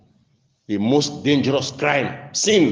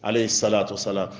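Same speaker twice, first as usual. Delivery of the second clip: ordinary delivery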